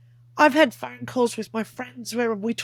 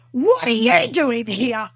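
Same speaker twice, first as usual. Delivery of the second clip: angry demand, louder and drawn out; angry great-grandmother; 5 dB louder, slower than usual. Retold or imitated. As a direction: scold an intruder slowly and forcefully. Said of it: "she was very angry with me".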